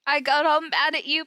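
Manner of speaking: ordinary talk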